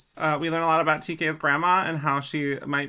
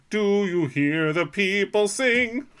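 theatrical; 6 semitones higher, 4 dB louder, slower than usual. Retold or imitated. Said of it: "the Mummimon were like".

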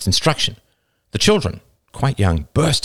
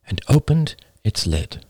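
plain reading voice

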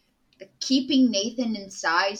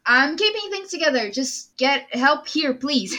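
higher, louder urgent plea; acted pleading in a raised voice; 6 semitones higher, 5 dB louder than usual. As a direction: call out for help while holding it together. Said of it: "raises his voice at them".

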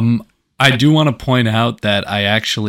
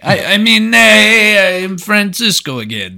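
put-on high, loud accent hastily put back on; Francisco; 9 semitones higher, 5 dB louder, slower than usual. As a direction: snap back into an exaggerated foreign accent. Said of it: "forgot he was supposed to have an accent".

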